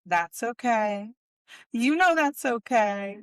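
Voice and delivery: drawn-out mock reassurance; slow, syrupy "it's okay"; imitating the stylist's slow soothing tone; slow soothing imitation. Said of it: "She's like".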